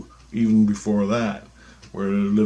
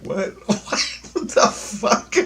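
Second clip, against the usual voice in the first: baffled laughing; 11 semitones higher, slower than usual. Retold or imitated. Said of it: "I'm like".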